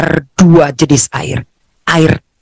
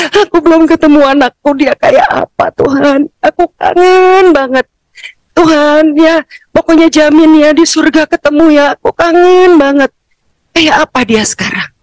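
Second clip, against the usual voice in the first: longing prayer; 9 semitones higher than usual. Retold or imitated. Mother begging God to see her son, high aching plea. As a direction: cry out how much you miss him.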